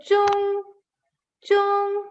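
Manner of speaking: "chung" on a high, level tone; model pronunciation. Shown as a demonstration. Teacher showing how Z H sounds, high level "chung". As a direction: hold "chung" high and steady with a clear ch. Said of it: "makes the sound ch".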